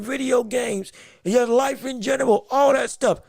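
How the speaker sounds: ordinary talk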